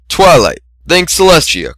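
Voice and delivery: high relieved exclamation; relieved cry. A narrator's reading. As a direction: gasp out relief. Relieved greeting.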